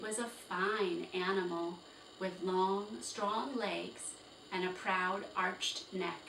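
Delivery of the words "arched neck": plain reading voice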